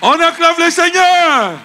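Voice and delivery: very high, loud preacher's cry; full rallying shout. Real, not acted. Preacher urges congregation to acclaim again, full shout. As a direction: shout the church up into applause.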